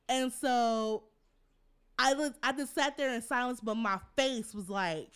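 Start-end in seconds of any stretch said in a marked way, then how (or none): none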